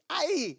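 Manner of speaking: high mock yelp at a belt lash; yelp of pain. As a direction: cry out as if whipped. Child being hit with belt.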